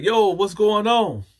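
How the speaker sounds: loud, high, drawn-out holler; retold calling out. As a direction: call out through a locked door. Calling out from locked cell.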